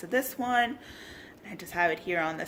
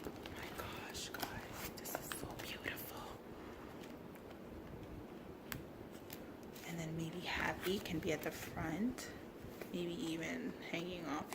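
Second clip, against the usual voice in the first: very soft whisper; awed whisper; 13 dB quieter than usual. Real, not acted. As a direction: hush it out in awe. Whispering in awe over craft.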